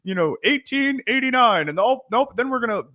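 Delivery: high-pitched mock teacher voice, held up high; put-on high lecturing voice; class announcement. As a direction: imitate a teacher introducing yet another syllabus. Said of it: "it would just be like".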